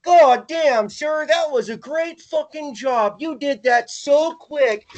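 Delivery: high, slow fawning voice; gushing mock admiration; mock praising boss. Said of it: "it's like".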